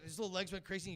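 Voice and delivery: ordinary delivery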